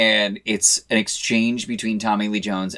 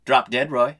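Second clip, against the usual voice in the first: grinning comeback; 3 semitones higher, 4 dB louder than usual. Retold or imitated. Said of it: "flashes the grin right back and says".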